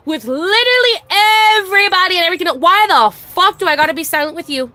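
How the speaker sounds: loud, high, real exasperation; fed-up rant hitting its peak; exasperated outburst. Real, not acted. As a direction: burst out in indignant protest.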